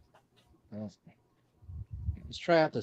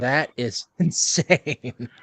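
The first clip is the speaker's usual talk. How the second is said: laughing; 3 semitones higher, 11 dB louder, much slower than usual. Real, not acted. Laughing at the broken mic.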